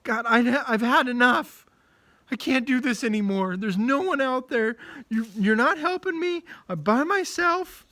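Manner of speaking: frightened prophet pleading to God; scared Elijah. Acted. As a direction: cry out to God, scared and alone. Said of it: "and he's really scared".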